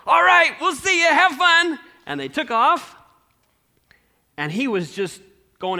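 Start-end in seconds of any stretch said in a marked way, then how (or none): 0.1-1.8 much higher, louder cheerful send-off; excited son waving his parents away; cheery goodbye. Acted. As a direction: wave them off with a big happy voice.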